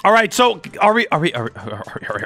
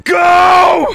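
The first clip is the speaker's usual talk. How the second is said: high, loud, drawn-out yell; launch shout; 11 semitones higher, 7 dB louder than usual. Real, not acted. Gamer launches the giant plane after countdown, excited shout. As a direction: holler the launch command.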